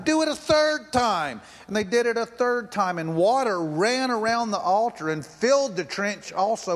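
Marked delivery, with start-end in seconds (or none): 0.0-1.4 commanding. A narrator's reading. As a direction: raise the order up, bold. Elijah orders the water poured.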